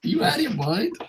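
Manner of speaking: high, hushed disbelief; incredulous. Real, not acted. Repeating the question to the commenter, hushed and high. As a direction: ask it softly, high and stunned.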